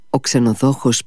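usual speaking voice

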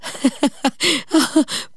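put-on high, silly drunken titter; drunk giggle. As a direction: giggle like a tipsy old man. (ψηλό μεθυσμένο γελάκι)

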